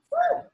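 excited high-pitched whoop. Real, not acted. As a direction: let out a big cheer. Cheering class on.

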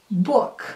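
"Book" with a hard, overdone K; exaggerated final K. Shown as a demonstration. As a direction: stress the K at the end of "Book". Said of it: "Eu vou dar uma exagerada nesses finais". ("Book" com K final exagerado)

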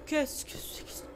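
hushed, breathless question; frightened whisper. Real, not acted. Player whispering, spooked by a noise in the game.

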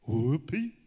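loud storybook whoop; excited shout. A narrator's reading. Bobby Bear finds the picnic basket, excited shout. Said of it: "shouted Bobby".

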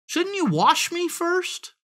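very high, innocent piglet question; wheedling piglet. A narrator's reading. Piglet talks the fox into a bath, wheedling. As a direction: suggest it sweetly in a high voice.

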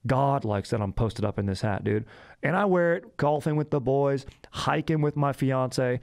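hyped-up surfer-dude sales patter; bro ad read. Acted. Podcast host pitching hats to listeners, hammed-up bro ad read. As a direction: stretch out the key words like a stoked bro.

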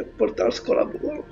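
usual speaking voice

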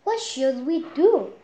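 high, loud anxious question; worried hen. Acted. Henny Penny asking the chick what to do, anxious. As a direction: ask in a flap.

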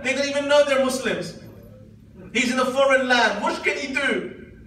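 high, loud, impassioned exclaiming; full-force preaching. Acted. Preacher exclaims over the husband's predicament, impassioned. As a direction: throw the questions out to the congregation, loud and high.

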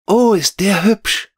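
put-on very high, delighted child-character cry; Veronika, entzückter Ausruf. A narrator's reading. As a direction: exclaim in high-pitched admiration. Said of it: "rief Veronika". (entzückt ausgerufen, ganz hohe Kinderstimme)

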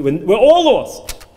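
slow plea rising high and loud; acted desperate cry. Father to daughter in the sea, desperate cry. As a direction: let the father's despair swell into a cry.

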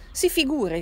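con foga. A narrator's reading. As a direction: jump in heatedly. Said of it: "risprese con foga". (con slancio, a voce più alta e forte)

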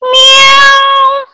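high, drawn-out meow; cat meow. Shown as a demonstration. Teacher shows pupils how a cat sounds, meowing. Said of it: "How does a cat sound?".

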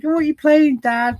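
put-on high, squeaky little boy; cute squeaky kid voice. Dad imitates young son asking about game, high squeaky child voice. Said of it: "his cute little squeaky voice".

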